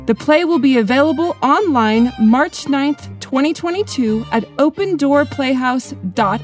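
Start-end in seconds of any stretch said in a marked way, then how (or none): none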